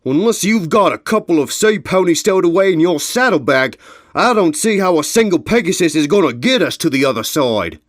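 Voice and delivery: loud, high-pitched gruff complaint; vicious objection. Acted. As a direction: scoff angrily at the whole plan. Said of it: "frowning viciously".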